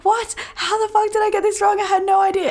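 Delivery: high-pitched mock disbelief; a high shocked squeak, hammed-up. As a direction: squeal it up high, faking horror. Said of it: "And I was like".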